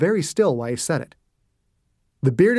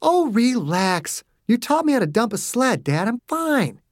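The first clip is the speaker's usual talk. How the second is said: dismissive; 7 semitones higher than usual. A narrator's reading. Daughter to her worried father, dismissive. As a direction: wave away a parent's worry. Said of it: "waved her hand dismissively".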